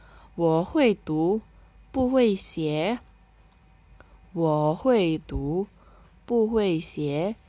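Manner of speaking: very slow, clear Mandarin, syllables spaced; slow model reading. Shown as a demonstration. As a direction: model it for learners to repeat. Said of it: "Kita baca ya".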